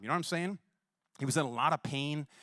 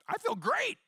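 high, bright exclaimed "great"; overjoyed after healing; excited. Retold or imitated. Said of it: "he's like".

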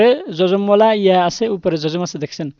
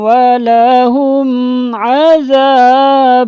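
Quran recitation; 3 semitones higher, 3 dB louder, much slower than usual. Shown as a demonstration. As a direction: recite each word long and sung like in the mosque. Teacher recites Quran verse. (ধীরে সুরেলা তিলাওয়াত)

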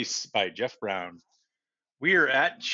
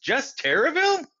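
put-on higher, amazed boy's cry; 12 semitones higher than usual. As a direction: cry it out in disbelief, up high. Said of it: "cried Arthur".

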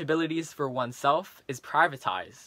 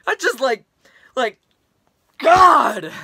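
louder, higher outburst of frustration; exasperated shout; 5 semitones higher, 11 dB louder, slower than usual. Acted. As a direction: burst out, fed up. Rant boils over.

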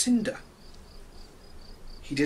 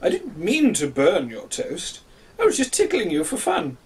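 sorry rooster; 2 semitones higher than usual. Acted. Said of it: "said Cocky".

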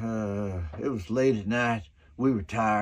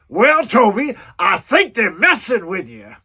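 much higher and louder, laughing retort; amused producer; 7 semitones higher, 10 dB louder than usual. Retold or imitated. Producer to the director Toby, loud and high.

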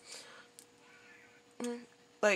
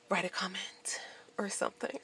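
soft whispered sign-off; whispered aside; 12 dB quieter than usual. Real, not acted. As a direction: drop to a whisper. Trailing off to viewers.

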